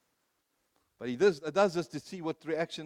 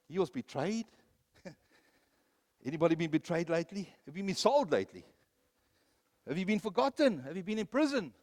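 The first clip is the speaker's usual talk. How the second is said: ordinary delivery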